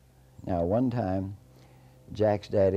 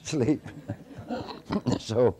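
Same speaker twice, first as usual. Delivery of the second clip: ordinary delivery, nothing marked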